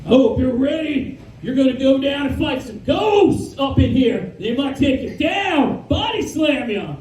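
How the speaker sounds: high, shouted hype-man promo voice; wrestler promo. Retold or imitated. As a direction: bellow it like a wrestling announcer. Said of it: "wrestler promo".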